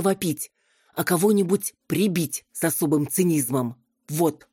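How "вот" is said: plain reading voice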